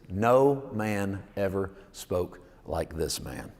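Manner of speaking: every word drawn out slowly; slow, savoured reading. Shown as a demonstration. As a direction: let the line sink in, word by word. Savoured favourite quote, read aloud.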